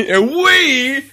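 loud, high show-opener yell; playful announcer shout; high-energy mock intro. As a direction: belt it out like an over-hyped host. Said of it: "give it that kind of energy".

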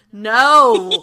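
really loud, exasperated refusal; Brandon, out loud. Acted. Brandon turns around on the prankster, very loud. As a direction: blurt the no at full volume. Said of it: "out loud, really loud".

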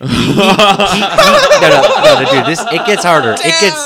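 loud, high protest through laughter; laughing insistence. Real, not acted. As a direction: push on with the story while cracking up. Insisting over everyone's laughter.

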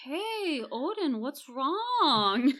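slow, falsely caring question; lightly voiced fake sympathy; mock concerned Hugo. Hugo to Odin, feigning concern, slow and sly. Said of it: "Hugo's like".

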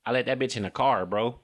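quick Detroit "car" with the r sounded; brisk Detroit delivery; Detroit accent. Acted. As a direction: say it Detroit-style, keeping the r in "car".

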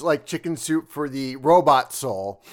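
ordinary delivery

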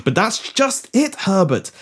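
high-pitched, urgent Prime Minister; acted, earnest epiphany; dramatic revelation. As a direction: press the revelation home with stagey urgency.

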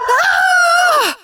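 sudden high scream of panic; yell of terror. Acted. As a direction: scream as you're swallowed. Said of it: "I yelled".

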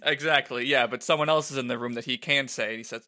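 usual speaking voice

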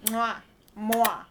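two smacking kiss noises; kissy noises. Acted. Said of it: "let's just not make the kissy noises".

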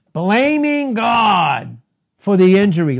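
slow, high, loud Blaming God; drawn-out pulpit cry of Blaming God; preaching shout. Real, not acted. Preacher hammering home his point.

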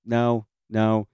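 loud refusal. Retold or imitated. Kid refusing to bring doll.

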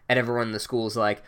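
ordinary talk

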